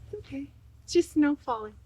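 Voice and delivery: soft, gentle comforting voice; hush-soft reassurance, lifting in pitch; soothing reassurance. Real, not acted. Vlogger breaks off to comfort honey about falling snow, soft and soothing.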